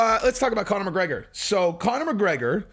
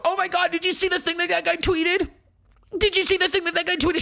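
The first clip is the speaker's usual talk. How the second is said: much higher, shrill mock-hysterical fan voice; mocking outraged fan; 13 semitones higher than usual. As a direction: mimic the pearl-clutching crowd, slowed and squealing. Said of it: "mocking outraged fans".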